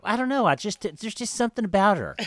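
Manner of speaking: high, loud mocking mom voice; lifted loud mom impression; mimicking mom. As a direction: squeak it out like her mother. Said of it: "My mom's like".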